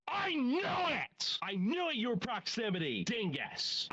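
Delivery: gleeful shout of vindication; triumphant outburst. Real, not acted. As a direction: stretch it out up high like you caught him red-handed. Unmasking the troll.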